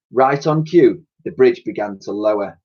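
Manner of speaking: plain reading voice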